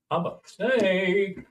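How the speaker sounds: slow, drawn-out snake man voice; a lightly voiced snake guy, slow and stretched; mock snake man, stretching each word; snake dude impression. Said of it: "do the impersonation of that snake dude".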